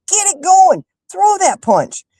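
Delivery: exasperated high-pitched mock yell; yelling at Mike. Fan scolds the boxer through the TV, mock yelling at Mike. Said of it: "I'm yelling at Mike".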